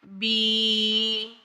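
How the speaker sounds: بی drawn out long and soft as a wrong example; stretched syllable. Shown as a demonstration. Teacher demonstrating the forbidden stretched بی. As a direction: pull the بی out too long. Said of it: "اس طریقے سے".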